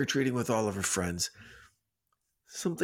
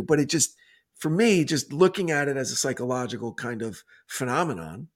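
ordinary talk, nothing marked

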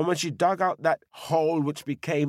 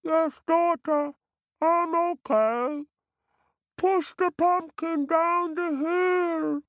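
put-on very high, thin old lady voice; high old woman voice. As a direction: squeak it out from inside. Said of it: "a voice inside said".

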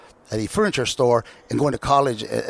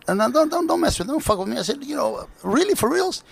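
much higher, excited pleading; imitating his own overexcited self; giddy disbelief; 13 semitones higher than usual. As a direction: beg him not to be joking. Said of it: "And I said".

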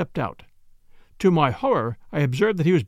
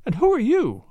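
high, mildly alarmed question; a touch of startled suspicion; lightly voiced anxious woman; frightened demand; 8 semitones higher than usual. A narrator's reading. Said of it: "with a quick, frightened glance".

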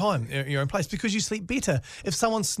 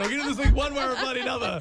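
determined; 8 semitones higher than usual. Pretend. Co-host teasing her about forcing the cap, mock determined. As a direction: play her as hell-bent on prying it open.

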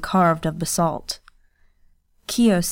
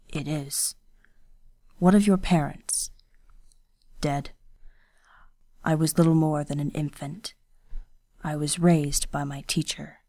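lightly voiced stern young ronin, hushed; a hint of a guarded swordsman speaking low; quiet, restrained ronin voice; Kenishi, low and quiet; 3 semitones lower, 4 dB quieter than usual.

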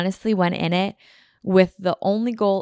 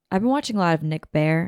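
normal talking voice

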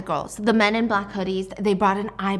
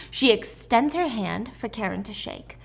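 ordinary delivery

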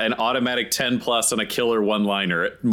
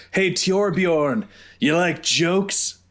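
slow, raised-pitch call down; mock yell; 5 semitones higher, much slower than usual. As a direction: draw out a taunt to someone below. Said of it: "then I yell down at him".

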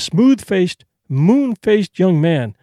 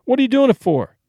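a higher, pointed demand; direct demand; 6 semitones higher than usual. A narrator's reading. Charlie presses Edna for her real motive, higher direct demand. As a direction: press her for a straight answer. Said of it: "he demanded directly".

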